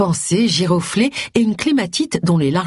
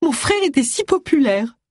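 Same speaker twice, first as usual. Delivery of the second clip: high, plaintive moan of a grieving sister; a lightly voiced lament, pitched high; 10 semitones higher than usual. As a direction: whimper it out in disbelief. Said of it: "gémit-elle".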